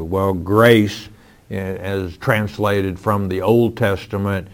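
ordinary delivery

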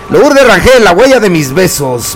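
usual speaking voice

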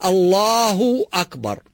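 الله أكبر with الله held five or six beats, slowly; correct length. Shown as a demonstration. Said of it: "خمس حركات أو ست حركات يكفي".

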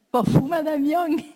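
a delighted, admiring exclamation. Real, not acted. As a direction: marvel at the boss's clever plan.

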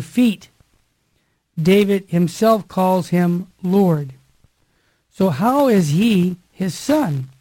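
loud, slow liturgical proclamation; solemn Gospel reading. Acted. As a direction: proclaim the Gospel to the listeners, slowly and loudly. Reads the Gospel aloud.

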